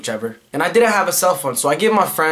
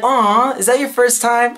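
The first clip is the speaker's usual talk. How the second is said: lightly voiced cop; 7 semitones higher, slower than usual. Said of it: "And he was like".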